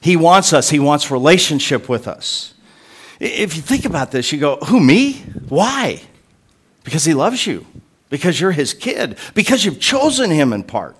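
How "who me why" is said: high, swooping "who, me?"; incredulous. Acted. Listener doubting that God wants them, incredulous. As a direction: act out a startled, disbelieving question. Acting out the listener's disbelieving reply.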